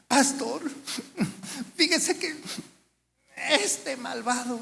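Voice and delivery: voz aguda de queja imitando al acusador; tono acusador. Retold or imitated. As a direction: acusa con voz chillona y lenta al final. Congregante acusa ante el pastor. (imitando una queja acusadora)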